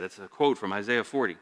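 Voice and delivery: ordinary talk, nothing marked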